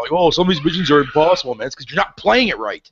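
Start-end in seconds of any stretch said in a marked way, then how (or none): 0.1-1.7 raised-pitch imitation of griping players; lightly put-on whiny complaint; mock complaining. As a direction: mimic people bitching about a game. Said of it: "bitching and crying about".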